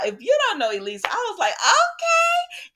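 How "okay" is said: surprised laugh. Real, not acted. Reaction on seeing Elise.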